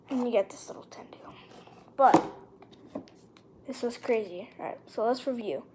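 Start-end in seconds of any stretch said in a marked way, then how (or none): 0.1-1.3 whisper. Real, not acted. Hushed aside about a card.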